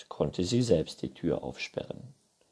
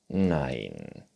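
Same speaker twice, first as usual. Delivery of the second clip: low, drawn-out refusal; Neinhorn; 6 semitones lower than usual. A narrator's reading. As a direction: refuse slowly as the unicorn. Unicorn figure refusing. (tiefes, gedehntes Nein)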